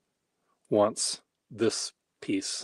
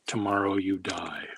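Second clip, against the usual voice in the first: menacing whisper. Shown as a demonstration. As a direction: whisper "Tomorrow you die" like a threat. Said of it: "now you write the word".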